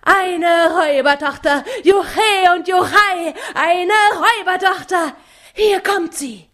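high, loud jubilant whoop; overjoyed cheer. A narrator's reading. Mattes announces his robber daughter, high and loud with joy. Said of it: "ganz von Sinnen vor Freude".

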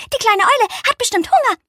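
hoch und schnell; flinkes Eichhörnchen. Acted. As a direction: ruf es hoch und hastig wie ein Eichhörnchen. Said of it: "und rief". (das Eichhörnchen ruft eifrig, hoch und flink)

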